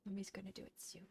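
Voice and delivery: softly whispered to the dog; hushed comfort to the puppy. Real, not acted. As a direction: whisper it close and tender.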